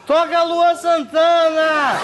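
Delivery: put-on drunk heckler shout. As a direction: bellow a song request like a drunk.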